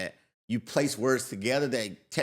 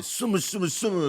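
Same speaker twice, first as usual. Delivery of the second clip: higher mocking mumble chant; mocking classmate; 7 semitones higher than usual. Kid making noise over his answers, higher mocking chant. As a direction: ape someone's slurred talk in a raised pitch. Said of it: "he would just start making that noise".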